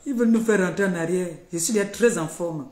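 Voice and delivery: boasting to viewers, loud and triumphant. Real, not acted. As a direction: proclaim it loudly. Boasting after laughing.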